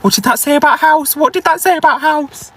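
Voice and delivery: high-pitched, urgent repeated question; alarmed urgency. Real, not acted. Woman to viewers after hearing the car, urgently alarmed.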